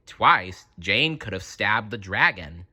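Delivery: ordinary delivery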